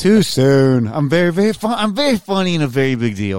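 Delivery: loud, high show-off brag; mock boastful. Real, not acted. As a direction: puff yourself up loudly. Mock boasting after joke.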